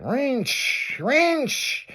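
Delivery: high, drawn-out engine whine; imitated turbo rev. Storyteller imitates the convertible's turbo, high revving noise. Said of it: "It's like".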